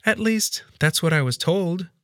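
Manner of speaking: high-pitched, guarded maid voice; suspicious. A narrator's reading. Maid qualifying what she knows of the wife, suspicious. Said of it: "the maid responded suspiciously".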